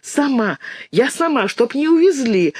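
put-on high little-girl voice on the edge of sobs; tearful, sobbing. A narrator's reading. Dinka explains to Lyonka why she is tied, tearful whimper. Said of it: "всхлипывая, ответила Динка".

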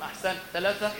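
ordinary talk

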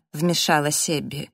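ordinary delivery, nothing marked

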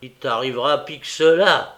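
loud, sharp warning; piqued, forceful retort; stung. A narrator's reading. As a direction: snap back with a threat. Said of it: "piqué de ses reproches".

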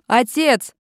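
loud high call. A narrator's reading. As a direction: shout for her father. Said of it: "с криком бросилась". (громкий зов)

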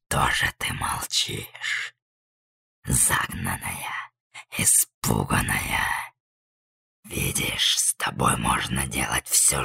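very deep, slow whispered monster. A narrator's reading. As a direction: taunt the prey in a monster whisper. Transformed monster taunts trapped girl.

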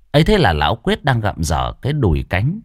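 plain reading voice

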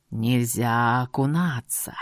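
very low, slow grating voice; creaky djinn voice. A narrator's reading. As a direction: grind it out, ghostly. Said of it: "скрипучим голосом".

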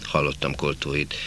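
plain reading voice

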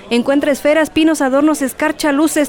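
high, fast, hawking stall patter; market vendor barker. Acted. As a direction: call customers into your stall. Vendor calling in Christmas market.

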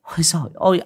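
exasperated whisper. A narrator's reading. Aunt exasperated mid-scolding.

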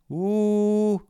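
high, held hoot; high sustained hooting; hooting hum. A narrator's reading. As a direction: drone a long oo on one high note. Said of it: "прогудел Фитюлька".

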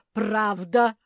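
high, very loud, rising retort; shouted insistence. A narrator's reading. Bear cub bristling at squirrel, shouted insistence. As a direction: yell it back stubbornly. Escalating childish argument.

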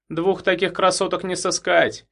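smirking. A narrator's reading. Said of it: "ухмыльнулся парень".

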